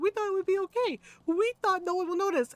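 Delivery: high-pitched, whiny imitation of nervous men; mock feeble excuse. Mocking the titos' excuse to Lola, high and feeble.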